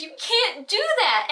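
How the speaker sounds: high, slow mock scolding; scolding dad. Dad scolding daughter over text, mock high rebuke. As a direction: lay down the rule like a shocked father. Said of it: "He said".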